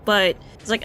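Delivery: ordinary talk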